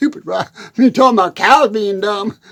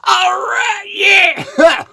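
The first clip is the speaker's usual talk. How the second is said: victory shout; 9 semitones higher than usual. Real, not acted. Farmer cheers after finally saying thermometer, shouting. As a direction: yell it out like you nailed it.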